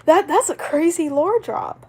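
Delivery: loud, high disbelief at the twist; shocked excitement. Real, not acted. Streamer reacting to a reveal, loud and stunned. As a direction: blurt it out, stunned and thrilled.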